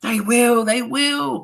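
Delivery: high, eager witches' cry; chorus it excitedly, high-pitched; thrilled crowd of witches; audience crying out. A narrator's reading. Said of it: "cried the audience".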